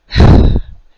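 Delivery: loud heavy exhale; exasperated sigh. Real, not acted. Vlogger fed up with the rudeness, sighing heavily. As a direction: let out a big exasperated breath.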